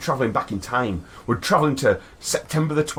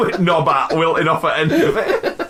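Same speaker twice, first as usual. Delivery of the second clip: words squeezed out while laughing; can barely talk for laughing; 12 semitones higher, 5 dB louder than usual. Real, not acted. Laughing hard at own joke.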